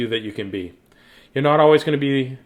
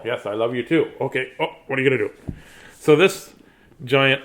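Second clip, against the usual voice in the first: usual speaking voice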